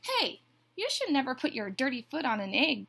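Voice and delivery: high, loud storybook telling-off; scolding. A narrator's reading. Duck tells Goose off over the egg, scolding. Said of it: "Duck scolded".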